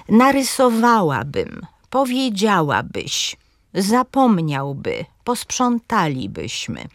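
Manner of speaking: narysoWAłabym, powieDZIAłabyś, measured slow list; antepenultimate stress. Shown as a demonstration. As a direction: stress the syllable before the endings in "Narysowałabym" and "powiedziałabyś". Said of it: "także akcent pada na trzecią sylabę".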